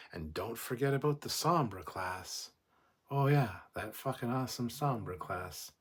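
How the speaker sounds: ordinary talk, nothing marked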